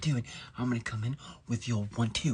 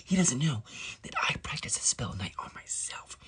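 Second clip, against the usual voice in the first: usual speaking voice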